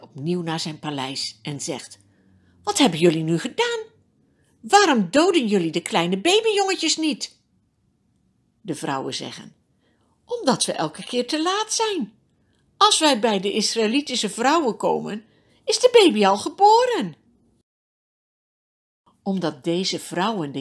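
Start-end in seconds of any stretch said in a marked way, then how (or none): 2.6-7.4 raised, indignant storybook king; angry demand. A narrator's reading. Pharaoh confronts the midwives over the babies, indignant scolding. 10.2-17.1 high-voiced women protesting innocence; pleading excuse. A narrator's reading. Midwives explain themselves to Pharaoh, high protesting voice.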